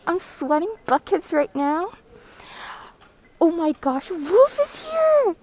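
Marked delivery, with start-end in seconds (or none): 3.4-5.4 high, loud, slow squeal; excited shout. Real, not acted. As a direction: shout the name with delight. Greeting a viewer excitedly.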